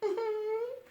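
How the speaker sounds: high little giggle; light giggle. Real, not acted. Laugh at own line.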